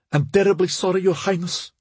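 high-pitched, anxious servant's apology; nervous apologetic maid. A narrator's reading. Maid who pricked her mistress, apologizing nervously.

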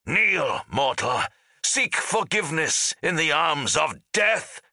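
high, harsh roared command; roaring. A narrator's reading. Ghost charging the giant leader, roaring. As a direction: roar it as a demand for surrender. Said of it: "he roared".

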